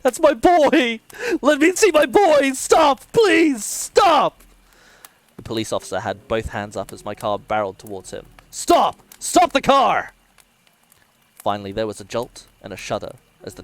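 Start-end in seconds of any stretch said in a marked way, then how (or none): 0.0-4.4 very high, full-voice begging; desperate pleading shout. A narrator's reading. Father begging them to stop. 8.5-10.1 very high, loud warning yell; alarmed shouted command. A narrator's reading. Officer yelling at oncoming car.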